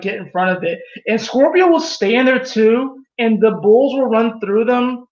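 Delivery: ordinary delivery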